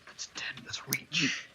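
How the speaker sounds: hushed, voiceless whisper; very soft whispered calculation to yourself; whispered aside. Real, not acted. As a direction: whisper it under your breath. Murmured aside working out spear reach.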